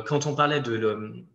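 usual speaking voice

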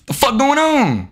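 high, exasperated demand; frustrated outburst. Real, not acted. Streamer with a failing mic, frustrated outburst. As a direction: demand to know what's wrong.

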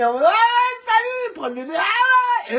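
high, slow fawning fan greeting; a fan gushing over a player; mock eager fan. Mimicking fans greeting a player for photos, high and gushing. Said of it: "c'est pour leur demander des photos".

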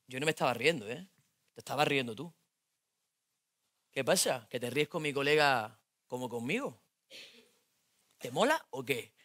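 put-on low, quiet menacing boyfriend; jealous boyfriend. Jealous boyfriend accusing girlfriend, low and quiet. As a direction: accuse her coldly and low.

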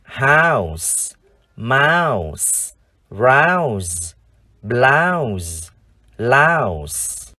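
"House, mouse, rouse, blouse, louse" each drawn out with pauses between; word-list drill. Shown as a demonstration. Teacher leads viewers re-reading five words, each long and separate. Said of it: "chúng ta cùng đọc lại năm từ này".

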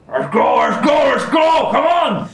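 loud high-pitched barked orc command; orc shouting. Acted. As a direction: yell it like a brutal orc leader. Orc rider yelling at goblins.

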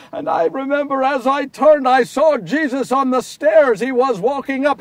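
loud, high-pitched torment; anguished confession. Acted. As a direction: relive the betrayal in anguish. Anguished memory of abandoning Jesus.

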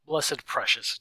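mother's lightly voiced baby talk. A narrator's reading. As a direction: gush over a little one in light baby talk. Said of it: "Daisy baby talked".

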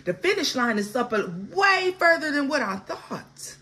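comic complaining of a worn-out racer, partly laughing; mock exhausted runner. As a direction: play the runner who's spent and hurting. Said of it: "you see the finish line and you like".

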